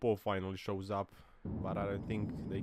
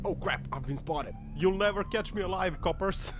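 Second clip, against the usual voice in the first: hammed-up defiant getaway driver; mock gangster driver; 8 semitones higher than usual. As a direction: taunt the police like an old movie crook. Voicing the fleeing driver.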